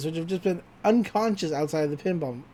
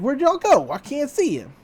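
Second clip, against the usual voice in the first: higher-pitched cowboy calling out; mock cowboy holler, pitched up high; 8 semitones higher than usual. Cowboy searching the saloon for the kids, imitated high cowboy voice. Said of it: "And the cowboy is like".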